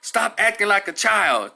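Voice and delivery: imitated officer's stern shout; scolding cop. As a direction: tell off a child forcefully. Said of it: "and then he tells her".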